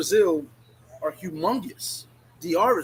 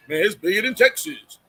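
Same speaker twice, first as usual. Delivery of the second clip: mock bragging; 4 dB louder than usual. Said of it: "but you telling me how big".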